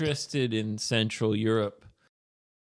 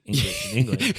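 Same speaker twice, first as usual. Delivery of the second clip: mock surprise; 4 dB louder, much faster than usual. Joking high exclamation.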